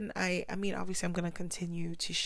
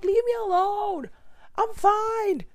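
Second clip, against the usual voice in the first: high, loud mock shout; mock yell; 8 dB louder, slower than usual. Pushing away caring church folks, a mock shout. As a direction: yell it in a hammed-up brush-off. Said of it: "I'm like".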